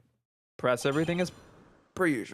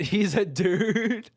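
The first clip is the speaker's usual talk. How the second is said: laughing; 6 semitones higher, 4 dB louder, much slower than usual. Real, not acted.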